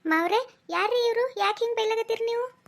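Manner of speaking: high, loud bewildered interruption; alarmed questioning. Acted. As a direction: break in, startled by the scolding. Alarmed in-law interrupts the scolding.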